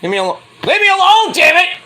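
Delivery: high loud fed-up shout; exasperated yell. Retold or imitated. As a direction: shriek it like you've had enough. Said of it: "I'm like".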